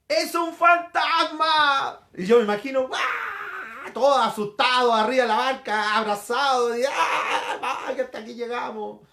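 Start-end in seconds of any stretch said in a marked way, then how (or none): 0.1-2.1 high, long drawn-out mock scream; terrified shout. Preacher acting the disciples who see a ghost, terrified shout. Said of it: "Y dice que gritaron". 2.9-9.0 hammed-up panicky wailing, half laughing; comic cries of terror from a storm-tossed crew; scared. Preacher imagining disciples clinging together in the boat, comically scared. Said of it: "Asustados por la situación".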